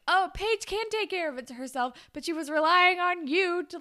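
high-pitched, lightly acted huff; mock indignant retort. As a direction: throw it back, playfully affronted. Acting friend's indignant retort.

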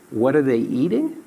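ordinary talk

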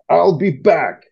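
Arnold-style German accent. Shown as a demonstration. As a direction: growl it like the Terminator. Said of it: "say that again in that perfect German accent".

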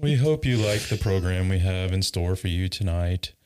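flat, lifeless delivery; deadpan robot voice; monotone voice. Acted. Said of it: "talk in a monotone voice".

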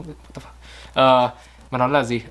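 usual speaking voice

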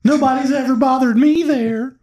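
squeaky put-on girl pitch, defending the place.

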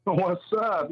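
normal talking voice

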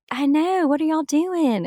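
high-pitched Southern twang; Southern accent. Acted. Actress demonstrating her old accent to interviewer, Southern accent. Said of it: "with a bit of a Southern accent, I instantly am like".

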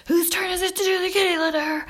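high, accusing mock nag; imitating the old nagging way of asking. To the kids, mocking how she used to nag about chores. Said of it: "I don't go".

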